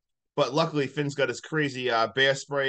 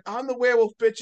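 crazed taunt; 8 semitones higher, 5 dB louder, faster than usual. Retold or imitated. Voicing crazed Marcus again.

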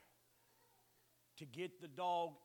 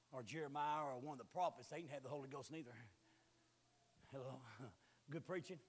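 normal talking voice

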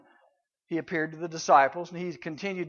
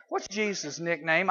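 ordinary delivery

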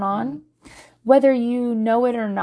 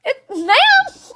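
high calling ma'am, louder; mock yell after; 6 dB louder, much slower than usual. Worker calling after the leaving customer, high mock yell. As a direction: call after someone leaving. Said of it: "while she was yelling".

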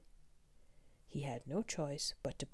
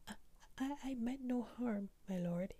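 stammered, higher pleading; stammering fear; 8 semitones higher than usual. Acted. Terrified merchant begs the Beast, higher stammering. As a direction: stumble over the words in fright. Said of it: "he grabbled".

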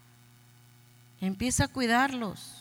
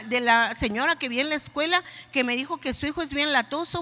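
usual speaking voice

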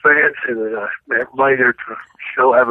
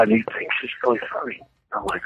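usual speaking voice

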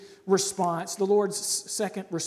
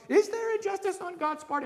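much higher, pressing question; impassioned question; 12 semitones higher than usual. Shown as a demonstration. Preacher asks the congregation, high and urgent. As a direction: throw the question up high, urging. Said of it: "that question".

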